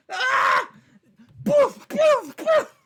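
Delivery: very high, loud yelled boof sound effects; face-smash noises. Pretend. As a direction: shout each boof like your head hits the table. Acting out smashing face on the table.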